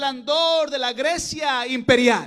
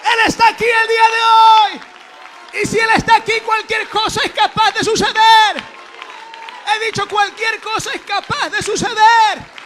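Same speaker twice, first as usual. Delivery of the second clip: high, very loud proclamation; fervent pulpit shouting; full-force preaching; 6 semitones higher, 11 dB louder than usual. Real, not acted. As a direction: declare it at the top of your voice.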